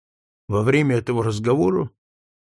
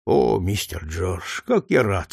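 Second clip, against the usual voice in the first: put-on lower, slow voice of a gentle old servant; a kindly old man touched to see the boy; old Tom, moved; 6 semitones lower, slower than usual. A narrator's reading. Tom greets George, lower slow voice.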